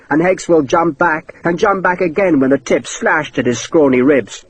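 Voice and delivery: plain reading voice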